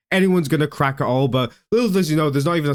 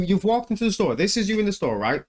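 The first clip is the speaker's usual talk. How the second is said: ordinary talk, nothing marked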